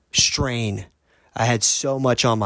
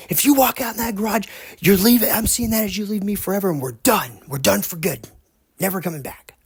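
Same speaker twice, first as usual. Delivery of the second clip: imitating angry partner; 9 semitones higher than usual. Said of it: "and she goes".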